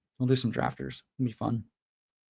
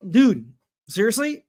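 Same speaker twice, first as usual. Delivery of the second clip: exasperated; 8 semitones higher, 3 dB louder than usual. Real, not acted. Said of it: "rant over".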